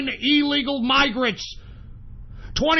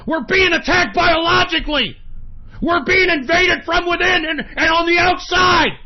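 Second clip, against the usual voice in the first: furious shouting; 4 semitones higher than usual. Real, not acted. Host shouts to his listeners about attacks, furious yell. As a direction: rage at being attacked. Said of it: "the reason I'm getting so pissed off".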